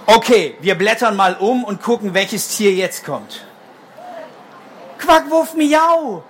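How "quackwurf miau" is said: high, slow little bird call. Acted. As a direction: chirp it out like a tiny bird. Reads the bird's call in the book.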